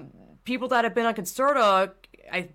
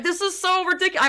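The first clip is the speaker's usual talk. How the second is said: sharply higher, incredulous scoff; exasperated scoff; 10 semitones higher than usual. Retold or imitated. Reacting to stimming videos, a high incredulous scoff. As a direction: throw it up high in disbelief. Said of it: "And I'm just like".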